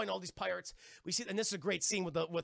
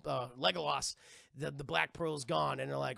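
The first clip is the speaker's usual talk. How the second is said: ordinary delivery, nothing marked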